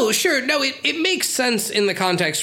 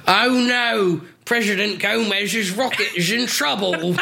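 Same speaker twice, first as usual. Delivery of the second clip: hammed-up Cockney line reading; mock-dramatic Cockney impression; Michael Caine; 3 semitones higher than usual. Shown as a demonstration. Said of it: "say this line in your Michael Caine accent".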